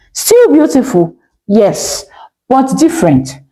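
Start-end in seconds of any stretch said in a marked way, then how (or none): none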